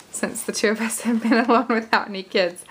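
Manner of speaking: talking through a little laugh; real giddy, excited chuckle. Real, not acted. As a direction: giggle partway through the words. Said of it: "I'm sorry, I'm laughing".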